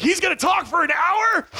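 high-pitched incredulous listener; put-on appalled squeal; mock dismay. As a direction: question it in hammed-up horror. Voices dismayed listener at long talk.

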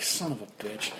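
low, soft exasperated curse; muttered curse. Retold or imitated. Driver as friend falls back onto him, muttered curse. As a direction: curse under your breath.

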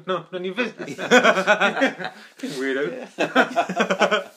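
laughing through mock reply; giggling as a rude stranger.

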